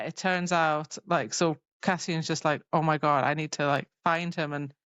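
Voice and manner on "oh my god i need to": normal talking voice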